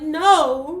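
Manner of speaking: loud, high wailed refusal; hammed-up tearful cry; mock crying wail. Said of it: "I'm crying. I'm like".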